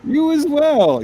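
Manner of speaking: high, slow, loud greeting; booming greeting. Acted. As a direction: belt it out slowly. Greeting in a big put-on voice.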